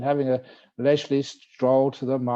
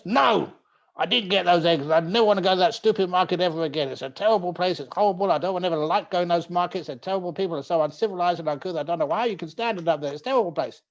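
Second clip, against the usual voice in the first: loud, fast, higher upset rant; upset husband; 5 semitones higher, 3 dB louder, faster than usual. Acted. Husband rants to his wife, loud and upset. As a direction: vent about that stupid market.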